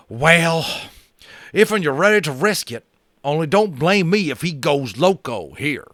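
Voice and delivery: high, loud grudging cowboy warning. Acted. Boone grudgingly warns about Little Bear, loud high cowboy. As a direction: grumble it in a high cowboy twang.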